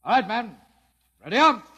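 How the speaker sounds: loud, high order to the squad; barked command. Acted. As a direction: snap the men to attention. Officer commanding his men.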